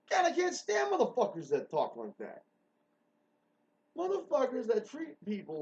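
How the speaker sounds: a fed-up shout; angry rant. Real, not acted. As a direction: vent your disgust loudly. Ranting about rude people.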